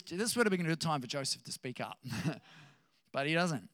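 ordinary talk, nothing marked

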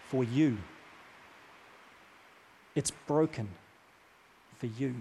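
soft insistent repetition. Retold or imitated. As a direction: press the words home gently. No, no, no; repeating words.

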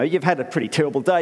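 ordinary talk

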